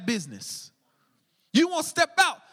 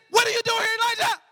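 higher and louder, a startling voice from nowhere; sudden booming call; 9 semitones higher, 4 dB louder, faster than usual. Acted. God surprising Elijah in the cave, loud and sudden. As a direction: burst in to catch him off guard. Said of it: "He surprised him".

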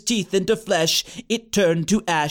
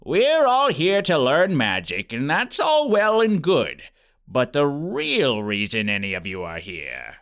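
lower, sinister turn; ominous principal; 5 semitones lower than usual. A narrator's reading.

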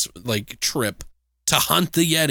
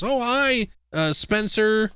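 higher, chirpy hello; sweet student greeting; 9 semitones higher, slower than usual. Retold or imitated. Redhead student greeting the janitor, high and chirpy. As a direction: greet him brightly like a keen student. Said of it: "goes up to him and goes".

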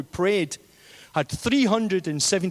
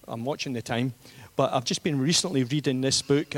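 ordinary talk

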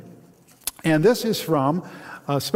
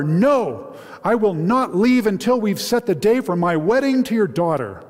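high, mildly louder insistence; roaring louder; 6 semitones higher, 3 dB louder than usual. Acted. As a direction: stand firm and roar him down. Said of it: "Joseph only roared the louder".

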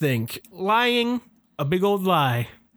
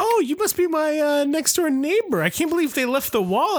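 higher, delighted put-on voice; chipper neighbor voice; 10 semitones higher than usual. [name] in the mirror meets his neighbor, higher and delighted. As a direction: greet him like a thrilled next-door neighbor.

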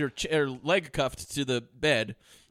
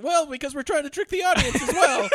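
higher put-on woman's voice; mock woman; 10 semitones higher, 3 dB louder than usual. High voice of the woman explaining the trick. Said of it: "he goes".

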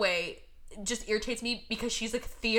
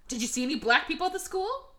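ordinary talk

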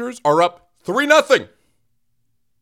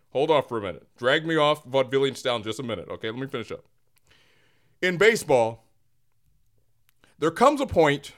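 ordinary talk

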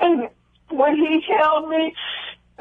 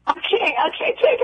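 retold crying; 7 semitones higher than usual. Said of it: "And I just kept crying and crying".

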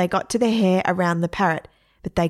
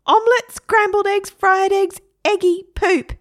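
very high, loud parrot; Harry; 13 semitones higher, 6 dB louder than usual. Acted. Parrot taunting boy about eggs, high squawk. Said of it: "said Harry".

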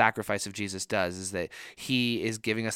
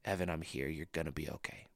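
much softer and lower, a gentle father's comfort; dad's tender reassurance; 5 semitones lower, 10 dB quieter than usual. Retold or imitated. Pastor repeats his father's words at his bedside, soft and tender. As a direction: hush a frightened child tenderly. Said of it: "put his hand on my shoulder and said".

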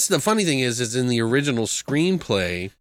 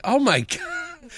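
slow, high, laughing oh my God; amused shock through a laugh; laughing disbelief; 13 semitones higher, slower than usual. Retold or imitated. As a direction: break up laughing mid-phrase. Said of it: "And I'm like".

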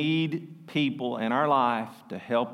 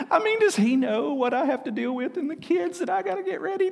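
high-pitched, hammed-up outraged whining; exaggerated high griping, stretched at the end; mock indignation; 14 semitones higher than usual. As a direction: protest like a put-upon employee, far above your usual pitch. Acts out indignant employee's complaint.

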